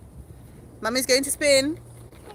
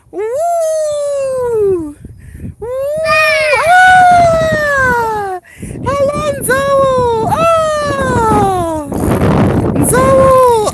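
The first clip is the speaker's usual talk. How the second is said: high, loud trumpeting and excited cries; hammed-up elephant chase; playful elephant game; 12 semitones higher, 5 dB louder, much slower than usual. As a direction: shriek and trumpet to play with the toddler. Playing elephant chase with toddler.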